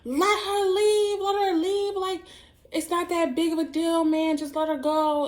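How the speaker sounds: imitated neighbours calling out, very high; screaming out the window. Neighbours urging the boyfriend to let her leave, screaming out. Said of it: "were screaming out the window".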